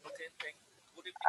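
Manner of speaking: very faint, far-off voice; speaking too softly to hear; barely audible. Real, not acted. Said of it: "Your voice is very low".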